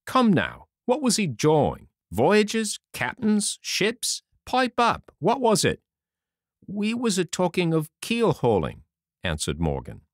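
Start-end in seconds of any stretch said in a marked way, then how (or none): 0.1-5.8 high, insistent questioning; impatient landlord firing questions; demanding interrogation. A narrator's reading. Long John interrogates Morgan. 6.7-8.8 lightly voiced sailor, pitched high; cornered sailor's meek confession; sheepish sailor. A narrator's reading. Morgan answers under questioning.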